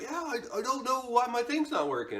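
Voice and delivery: high-pitched mimicked dude voice; mock an oddball pleading at the door; put-on high voice for a hapless guy; strange gym guy.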